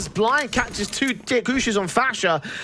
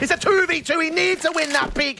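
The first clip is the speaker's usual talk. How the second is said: higher, pressing play-by-play; building hype call; 8 semitones higher than usual. Real, not acted. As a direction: ride the tension to overtime. Caster raising intensity through the final round.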